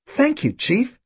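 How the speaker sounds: high-pitched, pleased thanks; grinning. A narrator's reading. Tim thanking his old chief, grinning. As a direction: take it as praise, with a grin. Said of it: "Tim grinned".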